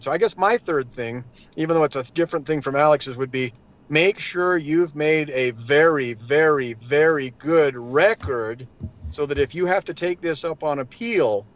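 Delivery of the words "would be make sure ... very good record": slow, drawn-out stress on "very, very, very good"; emphatic very very very. Acted. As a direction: lean on each "very" slowly, then land on "good". Stresses the key advice.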